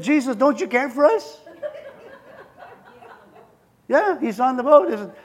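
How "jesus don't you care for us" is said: pleading disciples. Acted. Disciples crying out in storm.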